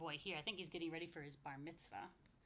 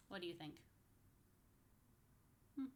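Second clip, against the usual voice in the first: plain reading voice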